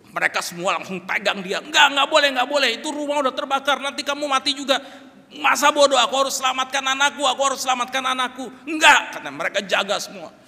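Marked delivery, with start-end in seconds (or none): 1.6-4.8 loud, high, urgent shouting; frantic forbidding. Acted. Family stopping father entering burning house, frantic shouting. 5.4-8.4 loud, high, insistent protest; desperate shouting. Acted. Father insisting he must save his child, desperate shouting. 8.7-9.2 one loud, high forbidding burst; shouted refusal. Acted. Family refusing to let father in, shouted refusal.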